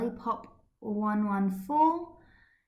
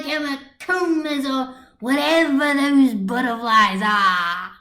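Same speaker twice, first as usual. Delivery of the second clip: put-on loud, higher jeering drunk; rowdy drunk shouting insults; loud drunken taunt; 5 semitones higher, 7 dB louder than usual. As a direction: yell the insults at him, tipsy and rude. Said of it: "flinging insults".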